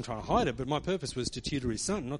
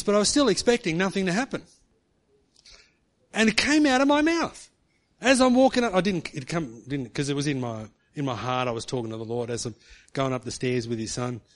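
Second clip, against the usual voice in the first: ordinary delivery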